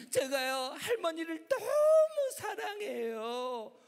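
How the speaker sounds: high, drawn-out loud wail; tearful schoolgirl. Acted. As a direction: bawl out the confession like a young girl. Girl tearfully confesses to pastor.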